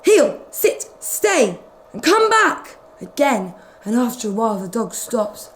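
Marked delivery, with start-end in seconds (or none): 0.0-2.8 higher barked commands; owners' commands. Acted. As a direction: order the dogs about. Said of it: "all shouted".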